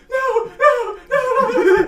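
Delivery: high, loud protesting wail; shrieking women. Acted. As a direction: shriek no over and over. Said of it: "the women are like".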